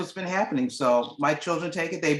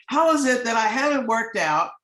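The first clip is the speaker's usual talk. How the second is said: amazed delight; 6 semitones higher, 4 dB louder than usual. Retold or imitated. Daughter amazed at weight loss.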